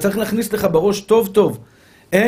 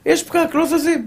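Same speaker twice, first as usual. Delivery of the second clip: mock driver's complaint; 10 semitones higher than usual. Acts out stuck driver's complaint.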